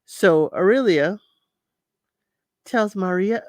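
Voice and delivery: ordinary delivery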